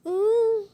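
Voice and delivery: high, loud pretend sob; mock wail. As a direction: wail loudly like a stage mourner. Pretend sobbing. (نحيب تمثيلي عالي وحاد)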